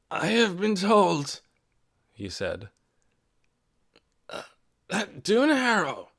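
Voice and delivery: put-on slow, labored old voice; weak old man. A narrator's reading. Sick old Ben struggling to address the meeting, slow and strained. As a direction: force each word out through weakness.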